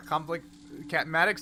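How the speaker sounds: ordinary talk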